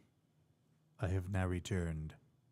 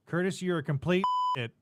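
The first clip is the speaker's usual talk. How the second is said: laughing mock insult; 8 semitones higher, 4 dB louder, faster than usual. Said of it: "shoot expletives into the microphone".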